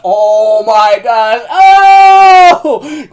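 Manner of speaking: excited shout. Retold or imitated. Fan overwhelmed by the kiss, shouting in delight. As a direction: blurt it out in delight. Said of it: "I freaking shouted that out loud".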